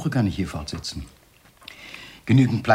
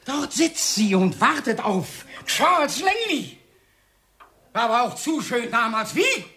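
frenzied taunting; 10 semitones higher than usual. Acted. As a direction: goad him, voice climbing to a cry. Jealous goading of rival.

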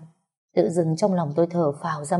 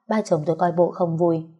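ordinary delivery, nothing marked

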